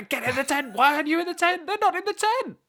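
very high, loud mock panic; imitated angry, flustered yelling. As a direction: mimic someone losing it and yelling. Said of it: "very rare angry and flustered Alex".

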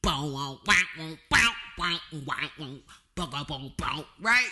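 beatbox a deep funky bass with your mouth; mouth bass line. Shown as a demonstration. Podcaster demonstrating the funky bass he wants, beatboxing bass. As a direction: thump out bass guitar notes vocally. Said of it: "that kind of deep".

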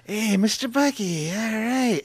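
a hint of slow, soft hippie dad; old hippie dad. Son imitating his hippie father's greeting, slow and soft. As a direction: lightly voice his laid-back father. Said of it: "I could do that voice".

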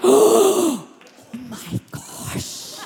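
high "oh" falling to a hush; awestruck, trailing into near whisper; stunned amazement. Acted. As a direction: start high, then drop to breath. Acted amazement as storm stops.

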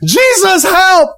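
a desperate shout to Jesus; cry for help. Retold or imitated. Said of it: "he cried out".

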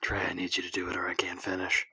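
soft unvoiced plea; whispering. Real, not acted. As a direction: whisper it, begging. Pleading with Trey again.

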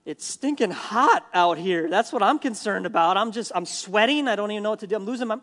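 loud, worked-up griping about the heat; exasperated complaint. Acted. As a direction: play Jonah losing patience, pitch climbing. Acting Jonah whining about the heat.

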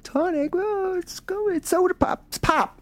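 very high, teasing girly mimicry; squeaky-high mock correction; mocking girls. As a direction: imitate girls making fun of a word. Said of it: "the girls always made fun of me".

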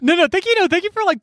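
gushing no, no, thank you; eager thanks. Real, not acted. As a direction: jump in high to reassure her. Thanking co-host after her comment.

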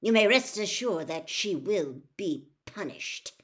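stern, cold pronouncement. A narrator's reading. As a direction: deliver the threat coldly. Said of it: "Mister Crouch added coldly".

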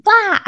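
high, silly panicked blah; mock panic blurt. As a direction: blurt out a frantic nonsense noise. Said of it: "That's what my brain will sound like".

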